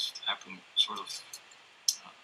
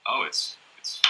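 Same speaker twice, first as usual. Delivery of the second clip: ordinary talk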